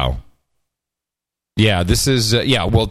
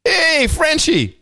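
high, slow, silly cartoon voice; mock goofy caller; 14 semitones higher, much slower than usual. Mock pilot radio call.

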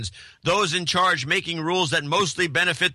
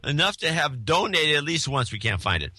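ordinary talk

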